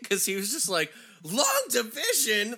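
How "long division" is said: loud high shout of disbelief; incredulous yell. Retold or imitated. Teacher can't believe her answer, incredulous shout. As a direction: echo it back, utterly dumbfounded. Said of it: "he couldn't even believe she said that".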